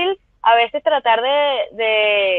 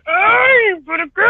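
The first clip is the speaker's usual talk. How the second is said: higher, half-shouted "ay, por qué"; exasperated cry; 7 semitones higher, 4 dB louder, much slower than usual. Retold or imitated. Actress reliving first-season frustration, exasperated cry. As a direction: throw up your hands, mock despair. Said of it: "es como que".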